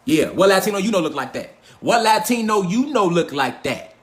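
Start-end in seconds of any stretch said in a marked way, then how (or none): 1.8-3.9 every word stretched out slowly; slow, drawn-out repeat. Real, not acted. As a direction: drag the question out for emphasis. Repeats the question more slowly.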